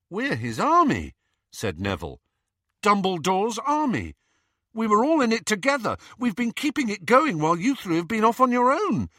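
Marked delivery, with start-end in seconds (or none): 0.1-1.1 higher, insistent protest; indignant protest. Acted. Neville insisting to Harry they are his army, indignant. 2.8-9.1 high, insistent, impassioned plea; indignant protest. Acted. Neville protesting to Harry at being left out, indignant.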